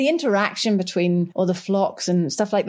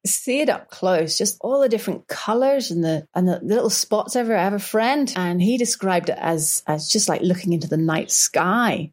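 normal talking voice

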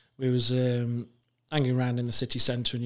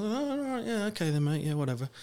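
dismissive; 8 semitones higher than usual. Retold or imitated. Mates dismiss his boast.

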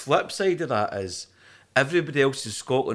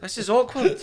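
high, laughing mock awkwardness; laughing mock embarrassment; 12 semitones higher than usual. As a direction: squeal it through a laugh.

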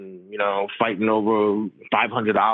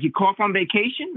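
pitched-up imitation of a blunt, know-it-all Long Island Italian doctor; 10 semitones higher than usual. Primary care doc scolding the patient about his cough, a higher, blunt imitation. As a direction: play the old family doctor, much higher, scoffing and certain. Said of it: "typical L-, Long Island Italian doctor".

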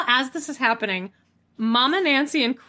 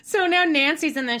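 ordinary talk

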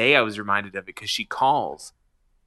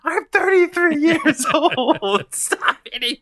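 very high, slow, laughing whine; hammed-up high pleading wail; whiny protest; 6 dB louder, slower than usual. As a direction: whine like a hit kid. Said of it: "all I say is".

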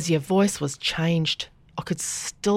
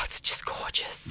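slow breathy whisper; 11 dB quieter, slower than usual. Real, not acted. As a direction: whisper it in awe. Whispered admiration.

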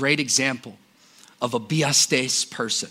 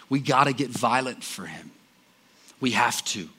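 ordinary talk

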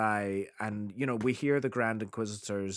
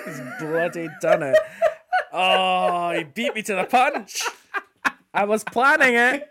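laughing; 9 semitones higher, 7 dB louder than usual. Real, not acted. Laughing after friend's surprise impression.

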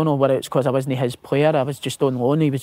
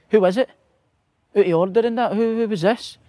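higher-pitched, slightly scared Scottish challenge; a bit scared; 7 semitones higher than usual. Retold or imitated. Young Scottish player challenging the changing room, a bit scared. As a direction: confront them while sounding a wee bit scared. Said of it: "kinda scared a wee bit, Scottish accent".

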